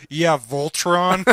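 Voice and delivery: deadpan silly name. Retold or imitated. Nate giving the cashier a fake name, slow and mock-proud. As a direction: announce it grandly.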